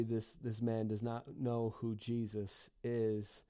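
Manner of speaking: ordinary talk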